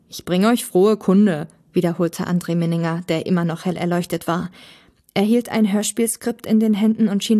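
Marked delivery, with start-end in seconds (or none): 0.1-1.5 slow, resonant proclamation; solemn herald. A narrator's reading. Glowing visitor brings tidings to three podcasters, solemn proclamation. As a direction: announce it like a heavenly messenger.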